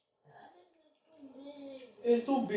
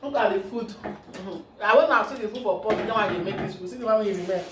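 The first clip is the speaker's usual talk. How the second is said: vlog host; 3 semitones higher, 7 dB louder than usual. Acted. Addresses viewers.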